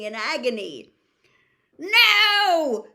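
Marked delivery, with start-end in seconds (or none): 1.8-2.9 high piercing scream. A narrator's reading. Villain screaming at the serpent, shrill shout. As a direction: shriek it out at full force. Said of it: "Harry heard Whittle screaming".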